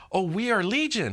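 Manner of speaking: high, mocking demon boast. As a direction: parody the demon's bragging, up high. Preacher mocks the demon's threat.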